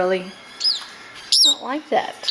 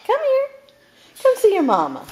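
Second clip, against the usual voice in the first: high, louder call to a pet; calling the bird; 13 semitones higher, 4 dB louder than usual. Real, not acted. Calling the bird to fly over.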